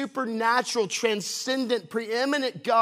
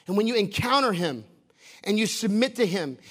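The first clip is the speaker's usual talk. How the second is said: ordinary talk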